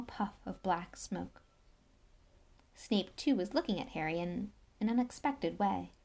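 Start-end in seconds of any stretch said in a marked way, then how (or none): none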